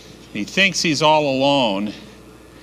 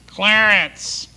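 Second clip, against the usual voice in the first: high squawky parrot reply; talking parrot answering the robber, high; 6 semitones higher than usual. Acted. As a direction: chirp it like a bird. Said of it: "So what's your name, parrot?".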